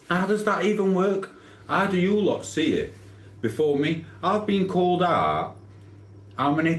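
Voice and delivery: high-pitched disbelief; baffled streamer, voice jumping high; incredulous. Real, not acted. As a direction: say it like you can't believe it. Exasperated about being called out.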